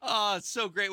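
ordinary talk

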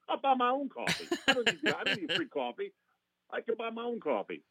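high, indignant lawyer impression; outraged high-pitched refusal; Jackie Childs. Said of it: "Jackie Chan says".

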